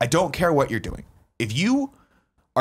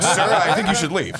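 laughing mock threat; 7 semitones higher, 4 dB louder than usual. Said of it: "I was like".

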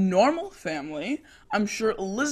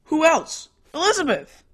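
higher, louder, impatient exclamation; exasperated outburst; 6 semitones higher, 5 dB louder than usual. Acted. Teenager telling mother whom they miss, loudly exasperated. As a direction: burst out as if it were obvious.

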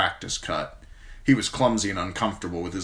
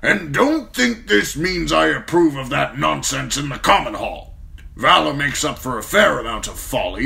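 old lord voiced higher and louder, scolding firmly; stern old commander; 8 semitones higher, 8 dB louder than usual. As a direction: lay down the law like the old commander. Mormont scolds John.

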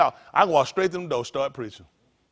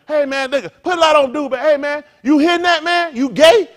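loud, higher jeering taunts ending in a shout; heckling comedian; 8 semitones higher, 10 dB louder than usual. Retold or imitated. Preacher imitating a comic taunting a man in the audience, loud and jeering. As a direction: needle the guy in front like a nasty comic.

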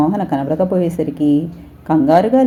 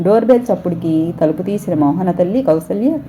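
ordinary delivery, nothing marked

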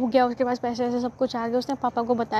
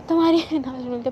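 ordinary talk, nothing marked

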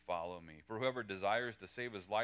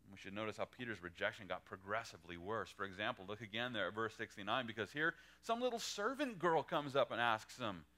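ordinary talk, nothing marked

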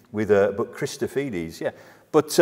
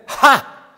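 high, explosive "ha"; cry of astonishment and fear; 13 semitones higher, 12 dB louder than usual. Acted. Preacher trying readings of the demon's cry, startled high "ha". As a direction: fire out a startled "ha". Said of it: "a note of wonder and fear".

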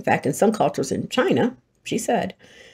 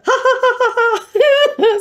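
high, loud ha-ha laughter; practiced laugh breaking real; 8 dB louder, slower than usual. Retold or imitated. Imitating the laughing exercise, high loud ha-ha laugh. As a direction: force out ha-has until real laughter takes over. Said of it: "exercise is".

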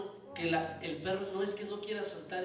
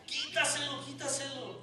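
urgent plea; 8 semitones higher than usual. Retold or imitated. Acted plea during a dog fight.

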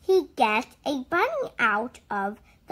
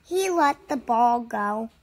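plain reading voice

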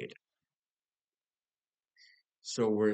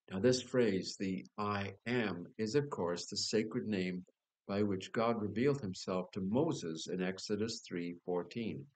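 ordinary talk, nothing marked

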